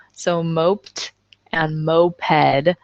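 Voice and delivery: slowly, moped as mope-d then moped as mo-ped; slow contrast. Shown as a demonstration. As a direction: contrast the two readings of moped. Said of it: "spelled exactly the same way".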